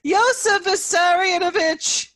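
high, loud cry calling out; old woman crying out to Stalin; ringing cry. A narrator's reading. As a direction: ring out the name in desperation. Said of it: "Yelena's voice rang out".

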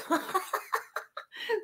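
breathy high giggle; high laugh. Real, not acted. Seller laughing at Becky's comment.

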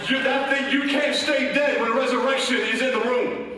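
rousing pulpit proclamation; full-force preaching. Real, not acted. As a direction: build to a shouted sermon climax. Preaches at full force, building to shout.

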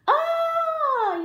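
very high, loud scream; long piercing shriek; Granny's yell. Acted. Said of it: "yelled Granny".

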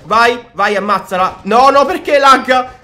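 fast, hyped reactions while playing; excited play-by-play. Real, not acted. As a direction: shout along at the game action. Excited live gameplay commentary.

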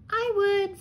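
high, small sea star voice; high little creature saying yes. A narrator's reading. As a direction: pipe up like a tiny sea star. Tiny sea star answers crab.

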